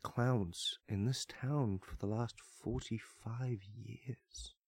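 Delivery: slow voice dropping to near silence; spooky fading whisper. Pretend. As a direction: let it fade into a creepy hush.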